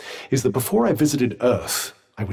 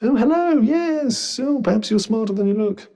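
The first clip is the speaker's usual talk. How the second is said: exaggerated, high-pitched hello; mock greeting; 7 semitones higher than usual. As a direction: pitch it right up as if finally noticed. Finally noticed by the wearer.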